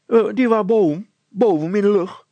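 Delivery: put-on high, loud childish voice; a cheeky little girl piping up; Petronella the girl. Girl answering the mayor, high and childish.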